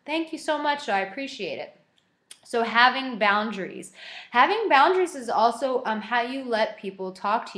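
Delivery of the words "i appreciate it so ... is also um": ordinary delivery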